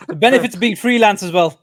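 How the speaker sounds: real laughter in the voice; laughing. Real, not acted. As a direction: crack up while agreeing with the joke. Host laughs at guest's joke.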